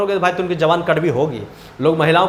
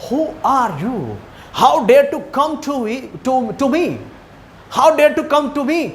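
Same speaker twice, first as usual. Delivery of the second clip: higher, slow indignant scolding; angry father; 5 semitones higher, much slower than usual. Acted. Father confronts the beggar at his door, indignant and high-pitched. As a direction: play the outraged father demanding answers. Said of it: "इसके पिताजी गर्म हो गए".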